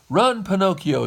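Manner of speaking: high, loud urgent shout. Acted. Geppetto tells Pinocchio to flee, shouting high and loud. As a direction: yell at him to run. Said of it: "shouted Geppetto".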